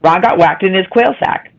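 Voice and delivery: "Ron got whacked in his quail sack" rattled off quickly; fast demo. Shown as a demonstration. Showing the friend how to say it fast. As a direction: show the tongue twister at full speed. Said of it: "Show us how to do it".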